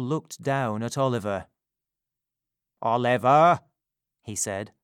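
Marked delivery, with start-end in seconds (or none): 2.8-3.6 pompous beadle. Acted. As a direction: order the boy about. Bumble calls the boy.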